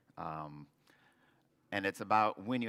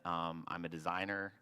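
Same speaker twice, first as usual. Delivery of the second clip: usual speaking voice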